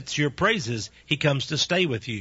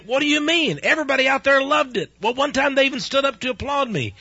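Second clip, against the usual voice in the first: high, loud, affronted disbelief; indignant protest; 11 semitones higher, 4 dB louder than usual. Retold or imitated. Husband protesting to wife about his sermon, high and indignant. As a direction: pitch it up, wounded pride. Protesting wife's critique, retold.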